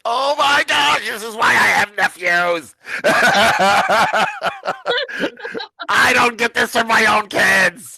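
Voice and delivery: high, loud, slow gushing through laughter; laughing delight. Retold or imitated. Aunt greeting her adoring nephew, loud laughing gush. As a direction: gush and laugh at being adored.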